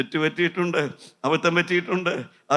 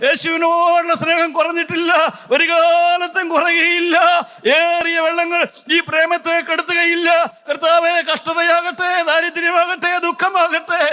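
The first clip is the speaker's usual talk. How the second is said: building from raised preaching to a high shout; climactic preaching; 11 semitones higher, 5 dB louder than usual. Acted. Preacher leading hands-raised vow to the Lord, building to a shout. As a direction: lift the crowd into a shouted vow.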